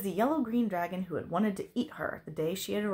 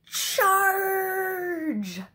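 high, loud, drawn-out rallying shout; shouted battle cry; 13 semitones higher, 9 dB louder than usual. A narrator's reading. Knight rushing at a dragon, shouting. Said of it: "shouted a familiar voice".

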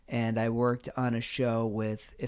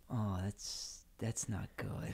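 softer, lower retold dismay; quiet dismay; 4 semitones lower, 11 dB quieter, slower than usual. Recalling seeing an old colleague on the marquee, quiet dismay. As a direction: let it sink in, hushed and halting.

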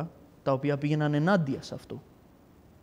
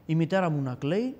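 usual speaking voice

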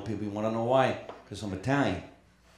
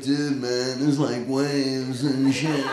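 surfer dude; 3 dB louder, much slower than usual. Retold or imitated. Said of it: "a California accent was gonna be surfer dudes".

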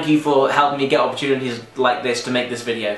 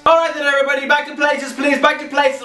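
higher, bossy director calling orders; movie director; 10 semitones higher than usual. Pretend. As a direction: call the crew back to places. Playing a movie director on set.

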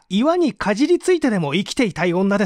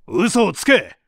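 angry retort; 2 semitones higher, 3 dB louder, slower than usual. Acted. Interrogator rejects the alibi.

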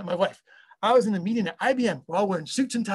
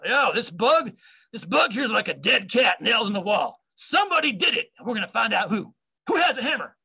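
higher, animated impression of an older colleague; hammed-up, swooping pitch; Bill Broman; 4 semitones higher, 3 dB louder than usual. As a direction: holler an analogy like an indignant old-timer. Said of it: "that was him".

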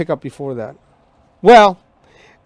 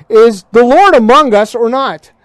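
louder and higher, pushing the question out; indignant demand; 4 semitones higher, 6 dB louder than usual. Retold or imitated. Preacher voicing grumbling Israelites challenging God, louder. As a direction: throw the challenge at the sky.

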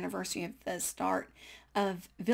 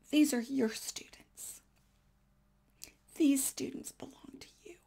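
hushed divine voice; 4 semitones higher than usual. Retold or imitated. God speaking in a vision.

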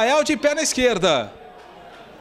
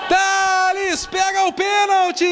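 explosive goal commentary; 7 semitones higher than usual. Real, not acted. Keeper saves deciding penalty.

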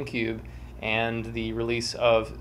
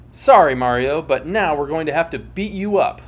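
lightly voiced mock apology, louder start; a hint of apologetic foes; 3 semitones higher, 7 dB louder than usual. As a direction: say it sorry but menacing. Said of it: "They landed and said".

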